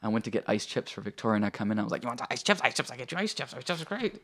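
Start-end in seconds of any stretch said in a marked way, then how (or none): 2.0-4.2 higher-pitched, jittery repetitive chatter; mock coffee-crazed babble. Husband on nurse's coffee offering ice chips, manic babble. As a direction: imitate being wired out of your mind. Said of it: "I couldn't talk straight".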